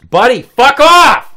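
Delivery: loud high shout; yelling at cat. Real, not acted.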